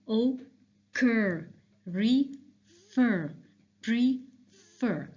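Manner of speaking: "Occur, refer, prefer" broken in two, weight on cur and fer; stressed second syllable. Shown as a demonstration. Teacher asking students to notice second part, "Occur, refer, prefer" split and end-stressed. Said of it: "notice the sound of the second part".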